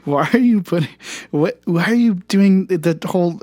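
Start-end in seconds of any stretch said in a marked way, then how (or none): none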